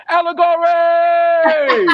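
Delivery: hammed-up gang boss rallying his squad; calling out to his squad. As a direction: summon the crew with a long rallying cry. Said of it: "He called out to his squad".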